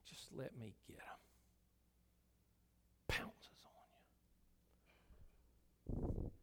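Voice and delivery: sin as a whispering beast; crouching beast whispering at the door; whispered sin voice. Acted. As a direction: hiss it like a lurking predator. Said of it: "crouches and says".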